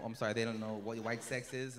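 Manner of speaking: ordinary talk, nothing marked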